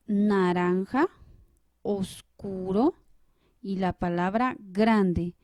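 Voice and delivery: each word said slowly and clearly, stressed syllable marked; slow example words. Shown as a demonstration. Teacher showing students the tonic syllable, slow and deliberate. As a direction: spell out naranja, oscuro, grande slowly, leaning on the stressed syllable. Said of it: "Tenemos tres palabras que son las siguientes".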